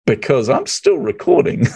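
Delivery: slow, high, laughing delivery; amused comeback. Retold or imitated. Host calling the guest's bluff, slow amused comeback. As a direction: draw it out with a laugh breaking in.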